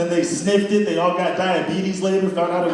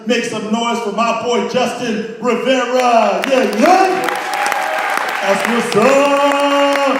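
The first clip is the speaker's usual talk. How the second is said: higher, louder, slowly stretched intro; announcer hype; 4 semitones higher, 4 dB louder, much slower than usual. Real, not acted. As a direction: drag out the name to the crowd. Introducing the comic.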